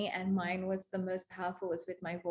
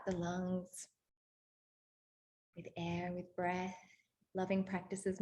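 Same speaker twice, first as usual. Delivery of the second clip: soft guided reflection; 3 semitones lower, 4 dB quieter than usual. Acted. Facilitator softly guiding the group's journaling, hushed. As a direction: lead them gently into reflection.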